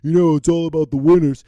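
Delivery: mocking put-on voice of everyone else; imitated griping, in a changed voice; complainer's voice. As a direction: parody the grumblers. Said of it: "Everyone else says a word".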